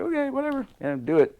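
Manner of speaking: airy mock nonchalance; careless shrug. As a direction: toss it off like it's no big deal. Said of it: "It's like".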